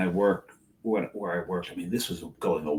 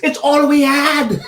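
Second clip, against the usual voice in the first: much higher, louder and slow, a put-on sheepish voice; sheepish excuse; 11 dB louder, slower than usual. Friend sheepishly admits the vodka was all they had, squeaky and drawn out. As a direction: squeak it out like a caught host with nothing else.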